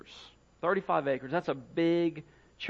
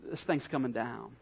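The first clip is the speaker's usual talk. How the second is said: low, soft, heavy-hearted thought; subdued emotion; 5 semitones lower, 7 dB quieter than usual. Retold or imitated. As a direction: say it quietly, as if with a lump in the throat. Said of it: "looked at it with emotion and thought".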